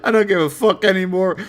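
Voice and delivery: high, laughing weary man; fed-up voice through giggles; Internet Historian. Retold or imitated. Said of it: "he looks like internet historian standing there".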